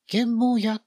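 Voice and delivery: put-on high-pitched mother calling her child; 呼びかける母親. A narrator's reading. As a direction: call the child over in a high voice. 母親が迎えに来て呼ぶ. (高い声で子を呼ぶ母親)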